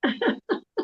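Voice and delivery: short high laugh of disbelief; high disbelieving laugh. Real, not acted. Mother laughing at the uncanny coincidence, high laugh.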